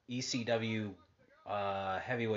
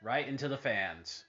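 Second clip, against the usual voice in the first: sudden loud call of a big spot; wrestling commentator; 7 dB louder than usual. Acted. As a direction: burst out as Shane dives over the rail. Calling the match on TV.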